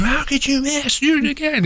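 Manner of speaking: dad scolding his son who missed, strained and high; imitated yelling. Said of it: "his dad was yelling at him".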